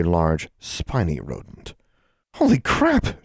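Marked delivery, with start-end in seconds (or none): 2.3-3.2 shocked high-pitched outburst; exclaiming. A narrator's reading. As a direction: blurt it out in shock. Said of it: "she exclaimed".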